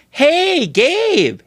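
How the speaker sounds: high sing-out hail to a salesman; chirpy customer greeting. Retold or imitated. Returning customer hailing salesman Gabe about a sofa, high mock-friendly call.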